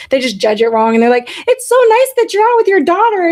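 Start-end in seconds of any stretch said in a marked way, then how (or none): 1.5-3.3 mocking stranger voice. Strangers mistaking husband for her dad.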